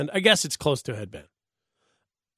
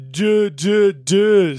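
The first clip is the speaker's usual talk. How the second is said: louder, punched-out catchphrase syllables; Chris Berman; 5 semitones higher, 6 dB louder, much slower than usual. Acted. Host suggesting a gift for Jeter, imitated broadcaster catchphrase. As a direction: mimic the broadcaster's booming triple beat. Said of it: "great reference to, uh, Chris Berman".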